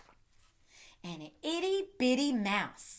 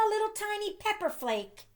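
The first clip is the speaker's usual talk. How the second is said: high tiny voice; 10 semitones higher, 3 dB quieter, faster than usual. A narrator's reading. Tiny pepper flake, high.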